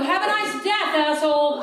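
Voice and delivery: high, loud sweet-sour sarcasm; sarcastic farewell. Retold or imitated. Through the car window to the cyclist, loud sarcasm. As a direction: wish him well with venom. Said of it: "I go".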